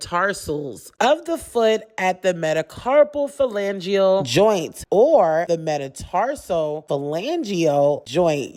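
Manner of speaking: ordinary talk, nothing marked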